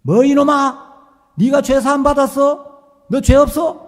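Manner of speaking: high, loud scolding shout; thundering rebuke. Retold or imitated. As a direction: thunder at the boy. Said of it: "갑자기 막 호통을 치시면".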